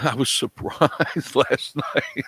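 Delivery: slow, laughing through the words; laughing while talking. Real, not acted. Host recalls last night's tech trouble, helplessly laughing. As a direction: break up laughing as you recall it.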